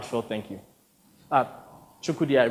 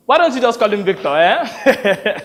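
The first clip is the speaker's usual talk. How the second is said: quick, louder, jokey suggestion; Sonny jumping in; 6 semitones higher, 6 dB louder than usual. Acted. Sonny rescuing the director, quick and jokey. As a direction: cut in fast with a grin.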